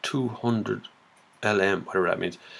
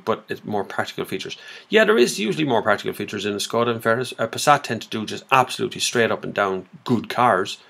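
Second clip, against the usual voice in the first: ordinary talk, nothing marked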